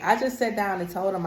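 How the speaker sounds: ordinary talk, nothing marked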